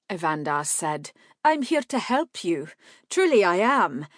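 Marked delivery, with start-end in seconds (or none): 1.4-4.1 reassuring. A narrator's reading. Evandar reassures.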